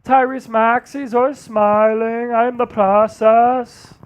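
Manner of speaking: slow, drawn-out player impression; Joel Embiid. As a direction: stretch every word in a put-on athlete voice. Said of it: "that's Joel Embiid".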